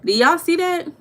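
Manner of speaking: high, loud incredulous question; can you believe this; outraged look-at-this; disbelief. Real, not acted. Showing the hair picture.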